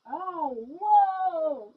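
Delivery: usual speaking voice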